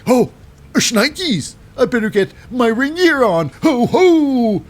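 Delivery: high, loud cartoonish yelp; mock panic, rushing off to gear up; startled Nathan. Nathan hearing the match is tonight, high loud mock panic. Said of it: "He's like".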